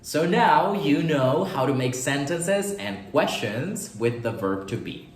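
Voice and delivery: slow, careful English wrap-up; slow English line. Shown as a demonstration. Teacher closes the lesson with a slow English summary. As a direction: say the summary slowly and clearly. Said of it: "closing English summary sentence".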